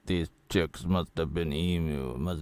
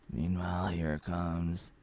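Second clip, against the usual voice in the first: normal talking voice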